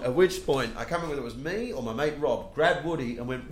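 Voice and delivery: normal talking voice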